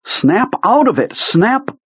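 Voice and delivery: high desperate shout. Acted. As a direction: shake him and shout. Shaking a dead man.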